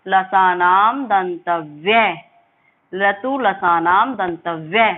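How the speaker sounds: लतु लसानाम दंतव्य recited slowly, each syllable drawn out; slow recitation. Shown as a demonstration. Teacher modelling the sutra before students try it. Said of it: "बोलने की कोशिश कीजिए".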